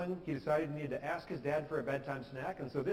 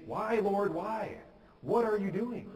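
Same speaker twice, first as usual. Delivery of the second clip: raised, high-pitched cry of protest; near-shouting plea; 7 semitones higher, 5 dB louder, slower than usual. Acted. Suffering believers questioning God, practically shouting. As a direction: cry out loudly as if demanding answers from God. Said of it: "they're practically shouting at God".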